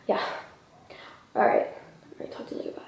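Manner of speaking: hushed, whispered imitation of a phone call; murmured phone sign-off. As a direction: whisper it like an overheard goodbye. Man ending his phone call, overheard.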